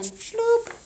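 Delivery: ordinary delivery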